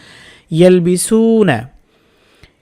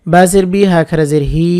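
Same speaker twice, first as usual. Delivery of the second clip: normal talking voice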